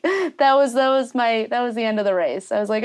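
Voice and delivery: normal talking voice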